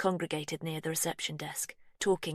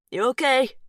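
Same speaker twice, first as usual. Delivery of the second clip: called out loud and high over the wind; calling out; 8 semitones higher, 10 dB louder than usual. A narrator's reading. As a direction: shout it across a stormy lawn. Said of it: "he calls".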